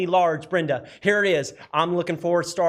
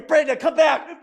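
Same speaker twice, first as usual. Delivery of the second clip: coaxing call; 8 semitones higher, 5 dB louder than usual. Real, not acted. Auctioneer coaxing bidder back in.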